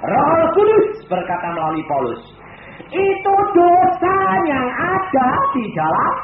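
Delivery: loud, slow preaching voice; fervent preaching. Acted. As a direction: drive the point home. Preaching emphasis. (khotbah lantang dan lambat)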